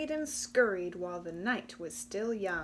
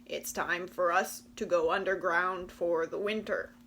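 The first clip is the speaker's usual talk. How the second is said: louder, croaky refusal; frog voice; 3 semitones lower, 5 dB louder than usual. Acted. The frogs explaining they must go underground, croaky. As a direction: croak as you head underground.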